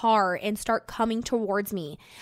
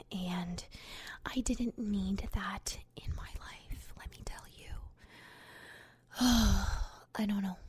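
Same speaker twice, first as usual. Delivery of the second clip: weary whisper; 10 dB quieter, slower than usual. Real, not acted. Confiding to viewers after the bad day, tired whisper. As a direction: drop to a slow whisper, almost to herself. Aside after ranting about the stranger.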